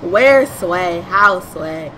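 very loud, drawn-out cry of confusion; mock exasperated shout. Lost player hollering at the game, mock exasperation. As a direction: holler it in hammed-up disbelief.

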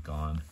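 put-on Gone said like the film title Gone Girl; teacher sounds out Gone. Shown as a demonstration. Teacher showing students how to say Gone. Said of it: "so you might put an E at the end".

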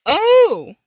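high, loud burst of surprise; surprised oh. Real, not acted. Player spots a gift, loud surprised gasp. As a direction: caught off guard, blurt it out.

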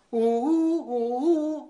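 imitating the machine hooting; hooting noise. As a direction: hoot like a wailing alarm. Mimicking the gadget's hooting.

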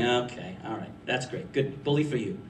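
low, soft, dismissive humoring; dry, under-the-breath approval; unimpressed brush-off. Retold or imitated. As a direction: mutter polite praise you don't mean. Brushing off a self-flatterer.

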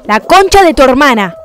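loud furious curse; angry shout. Real, not acted. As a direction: bellow the curse. Insulta al perder. (insulto gritado con furia)

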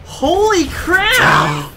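high, slow, drawn-out exclamation; astonished. Real, not acted. Watching a huge blast wipe out worms, high drawn-out astonishment. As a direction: stretch it out in awe.

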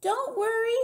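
very high, loud character shout; high-pitched holler. A narrator's reading. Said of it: "she kept shouting".

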